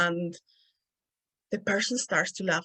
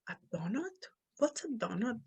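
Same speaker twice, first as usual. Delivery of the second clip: quiet, puzzled questioning of an odd word; puzzled friend; 3 semitones higher, 10 dB quieter than usual. Retold or imitated. Friend not understanding her accented donut, puzzled quiet questions. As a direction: softly echo the word, baffled. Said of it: "And she was like".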